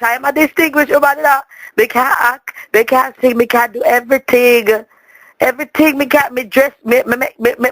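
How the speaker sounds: ordinary talk, nothing marked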